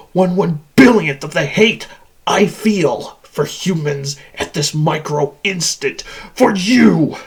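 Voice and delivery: loathing that swells to a shout; building hatred. Acted. Machine measures its hate for humans, rising fury. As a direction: drive it up to a loud final accusation.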